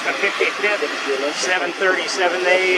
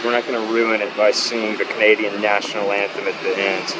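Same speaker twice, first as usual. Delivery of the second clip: ordinary talk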